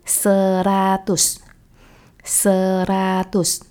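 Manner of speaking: seratus drawn out slowly, twice; slow teaching repetition. Shown as a demonstration. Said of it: "就是se加ratus".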